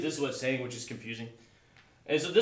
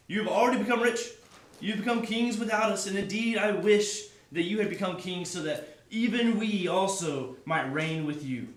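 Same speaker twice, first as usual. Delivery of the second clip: higher, louder mock praise; dripping sarcasm; 5 semitones higher, 3 dB louder than usual. Paul mocking the proud Corinthians, sarcastically. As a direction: heap on fake admiration. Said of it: "he's dripping with sarcasm and irony here".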